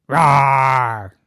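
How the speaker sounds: big loud mock roar; hammed-up dragon roar. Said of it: "Just kidding. Dragons don't roar".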